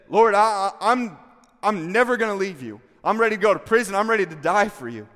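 loud, high, stumbling into a bold pledge; fervent vow. Acted. Peter pledging his life to his Lord, fervent vow.

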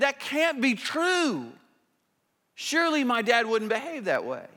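mild disbelief. Acted. [name] telling David his dad wouldn't do that, mildly disbelieving. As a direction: insist gently that it just can't be so. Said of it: "[name] really doesn't wanna believe this".

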